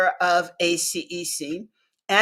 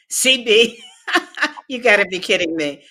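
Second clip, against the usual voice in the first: laughing through a louder name call; laughing disbelief; 3 semitones higher, 5 dB louder, faster than usual. Retold or imitated. Caller laughing at her for doubting her courage, amused. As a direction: chuckle it out, amused and incredulous.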